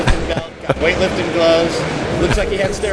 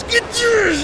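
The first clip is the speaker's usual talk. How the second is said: much higher, pumped-up call; hyped-up yell. Retold or imitated. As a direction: fire up the crowd. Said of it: "And he goes".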